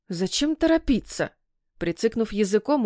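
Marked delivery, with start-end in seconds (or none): 0.1-1.3 higher-pitched chiding question; reproachful. A narrator's reading. Ilya's mother objects to the hurry, reproachfully. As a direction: chide them lightly, pitch lifted. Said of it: "упрекнула мама Ильи".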